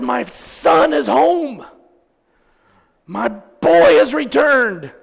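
loud, high, slow proclamation; jubilant father. Acted. Preacher as father greeting his returned son, jubilant proclamation. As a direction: cry it out, stretching every word.

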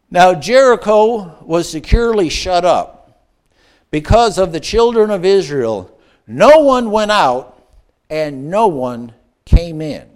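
slow, loud, weighty scripture read. Acted. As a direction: read the verse slowly and gravely. Reads scripture slowly, loud.

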